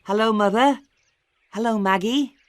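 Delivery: put-on boy home from school saying hello; lightly voiced boy. A narrator's reading. Tom greeting mother and sister, lightly cheerful.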